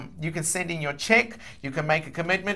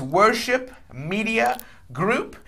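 WORSHIP, MEDIA, GROUP slowly, word by word; slow, clear repeat; 5 semitones higher, much slower than usual. Real, not acted. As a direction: space out Worship Media Group as if for note-takers. Repeats payee name for clarity.

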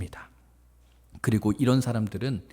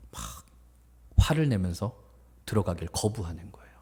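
ordinary talk, nothing marked

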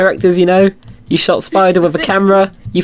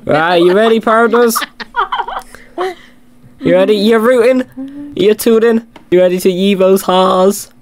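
higher, rallying cowboy call; 6 semitones higher than usual. Pretend. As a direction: rouse the partners like a mock cowboy. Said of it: "commentate this in my cowboy voice".

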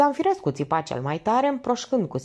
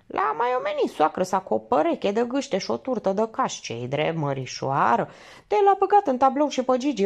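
lightly voiced chatty woman, higher; a hint of a gabbling old peasant wife; gossiping village woman; 7 semitones higher than usual. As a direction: rattle on like a gossiping countrywoman. Woman's chatter retold in her voice.

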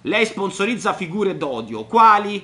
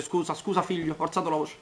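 mock tender apology; 3 semitones lower, 7 dB quieter than usual. Soft mock apology after yelling.

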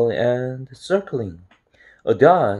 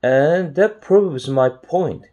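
high, slow, drawn-out declaration of victory; triumphant; 6 semitones higher, 4 dB louder, slower than usual. A narrator's reading. Chester claims he has proved his point, triumphant. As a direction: land the winning point proudly. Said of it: "he cried triumphantly".

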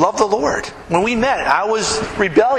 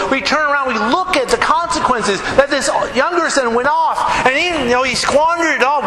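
raised, driving preacher's voice; preaching at full force; 9 semitones higher than usual. Acted. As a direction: preach it out to the congregation. Preacher's main sermon passage.

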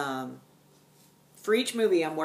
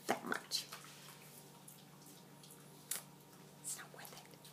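whisper; 16 dB quieter than usual. Real, not acted. Whispered aside on little progress.

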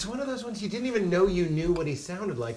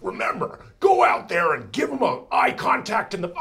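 Tony Robbins; 3 semitones higher, 5 dB louder than usual. Acted. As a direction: coach an audience, growing louder. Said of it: "Tony Robbins when he makes a mistake".

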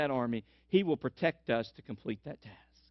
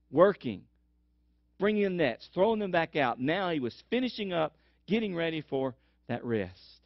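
ordinary talk

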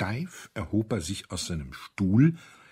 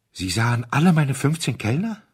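higher and louder, stunned; shocked disbelief; 7 semitones higher, 7 dB louder than usual. A narrator's reading. Owner asking the duke in stunned disbelief. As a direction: ask in trembling shock. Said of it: "Zitternd, vor Überraschung fast gelähmt".

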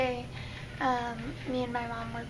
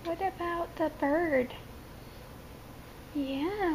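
ordinary talk

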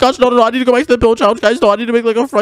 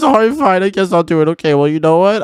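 normal talking voice

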